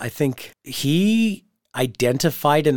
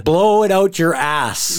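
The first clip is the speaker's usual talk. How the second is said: louder, slow snarling taunt; heel taunt; 6 semitones higher, 6 dB louder, slower than usual. Pretend. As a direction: sneer it like a wrestling villain. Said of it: "I am a heel".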